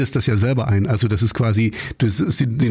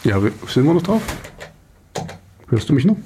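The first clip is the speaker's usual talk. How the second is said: far from mic; 3 semitones higher, faster than usual. Real, not acted. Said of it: "ich höre dich so komisch".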